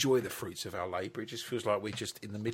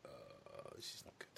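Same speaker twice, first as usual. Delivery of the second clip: low, much softer groan of dread; groggy; 23 dB quieter than usual. Retold or imitated. Host recalling waking up not wanting to work. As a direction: mutter it half awake. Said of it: "I was like".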